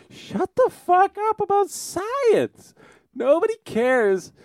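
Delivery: real exasperation boiling over; shrieking at science fans, drawn out; heated rant. Real, not acted. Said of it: "I'm a little heated".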